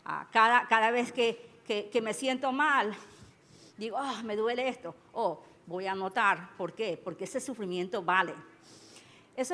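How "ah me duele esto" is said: ordinary talk, nothing marked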